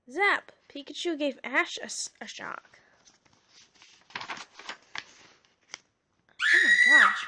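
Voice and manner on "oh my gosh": sudden loud real outburst; shocked shout. Real, not acted. Reader reacting to the shock, startled shout.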